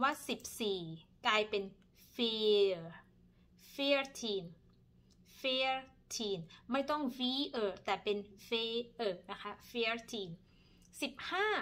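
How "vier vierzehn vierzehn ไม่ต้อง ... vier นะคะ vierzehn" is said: vierzehn said slowly with an f sound at the start; Dutch veertien. Shown as a demonstration. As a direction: vier in vierzehn becomes fier, drawn out. (ออกเสียง vierzehn ช้าๆ ขึ้นต้นเสียง f)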